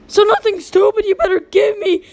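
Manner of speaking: high, loud insult thrown at him; shouting down a lying friend; angry yell. Acted. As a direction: snap back hard at the thief. Arguing with the toy thief.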